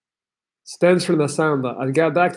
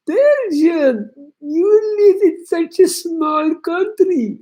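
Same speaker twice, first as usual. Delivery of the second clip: high, slow mocking voice of a French girl; 3 dB louder, much slower than usual. Traveller imitating the French girl he met in Colombia, high and mocking. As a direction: parody her like a whining snob. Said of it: "she's like".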